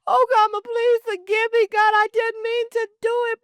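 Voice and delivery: high, loud, desperate pleading; a child pleading too late at the graveside. Retold or imitated. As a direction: beg forgiveness with your voice cracking high. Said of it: "you can plead".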